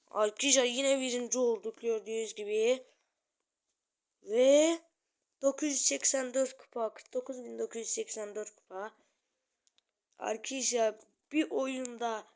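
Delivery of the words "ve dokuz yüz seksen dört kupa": excited announcement. Real, not acted. Announcing trophy count excitedly.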